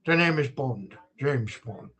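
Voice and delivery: low, slurred Scottish Connery voice; Sean Connery impression. Streamer shows off another voice, a low Sean Connery. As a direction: purr the name like Connery playing Bond. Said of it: "It's like Sean Connery as well".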